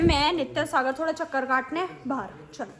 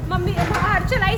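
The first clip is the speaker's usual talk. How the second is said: ordinary talk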